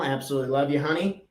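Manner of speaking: usual speaking voice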